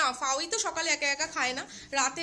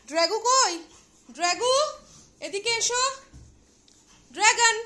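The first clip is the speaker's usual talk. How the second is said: high, loud call searching for the pet; 9 semitones higher, 11 dB louder than usual. Real, not acted. Owner calling Dragon from under the bed, high loud call. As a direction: sing out his name to coax him out.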